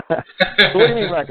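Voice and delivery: breaking into a high laugh mid-line; giggling as the question starts. Real, not acted. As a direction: laugh through the words. Laughing retelling of own joke.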